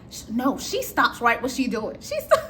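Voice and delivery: ordinary talk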